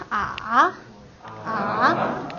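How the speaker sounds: AH dipping low, then rising; full third tone. Shown as a demonstration. Teacher reviews full third tone, dipping then rising. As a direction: swing AH down and back up. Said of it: "called a full third tone".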